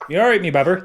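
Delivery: copying Bristol 'me babber'; the GM repeating the offered accent, Bristolian. Shown as a demonstration. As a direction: try the Bristol line back. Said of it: "That's it".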